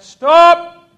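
high, very loud yell. Retold or imitated. Sergeant yells at departing recruits, very loud. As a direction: yell to stop them in their tracks. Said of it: "he yelled out".

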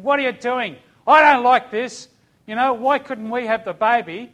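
high, loud outburst of retold grief; screamed protest. Father who lost the baby pleading with God, shouting. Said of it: "I'm screaming at him, I'm crying".

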